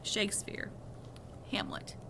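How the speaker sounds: usual speaking voice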